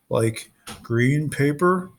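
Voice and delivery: slow, hesitant question. Acted. Tyson unsure about cash, slow and hesitant. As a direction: ask it slowly, unsure. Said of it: "Tyson asked hesitantly".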